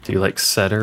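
ordinary delivery

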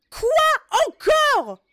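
high, loud exasperated yelling; angry shouting. Pretend. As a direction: yell back, fed up. Yelling back at nagging character.

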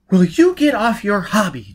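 loud, high exclamation; exasperated. Acted. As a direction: explode at him in exasperation. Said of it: "exclaimed in exasperation".

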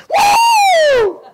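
high, long "woo"; loud falsetto-high cheer; whoop. Shown as a demonstration. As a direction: let out a soaring revival whoop. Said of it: "That's my new thing for this year".

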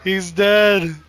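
loud shouted alarm; shouting. Real, not acted. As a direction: yell it over the chaos. Shouting in chaos.

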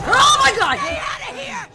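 high, loud scream of panic; real panicked shriek; terrified shout. Real, not acted. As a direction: scream it in sudden fright. Jump scare.

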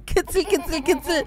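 high, playful singsong chant; teasing high-pitched tickle voice; tickling baby talk. Pretend. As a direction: coo it while tickling a baby.

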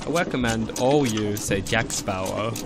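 ordinary delivery

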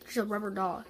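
ordinary talk

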